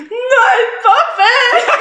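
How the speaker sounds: high, slow laughing wail; hammed-up sobbing cry; mock wail. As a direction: howl it like a toddler in despair. Retelling her crying fit.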